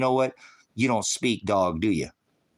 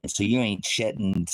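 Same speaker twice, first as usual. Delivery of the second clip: ordinary talk, nothing marked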